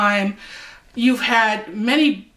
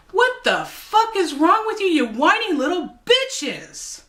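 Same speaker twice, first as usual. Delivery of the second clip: exasperated outburst; 9 semitones higher than usual. Real, not acted. Exasperated rant at protesters.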